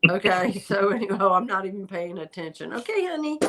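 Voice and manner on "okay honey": pet talk. Real, not acted. Talking sweetly to her pet.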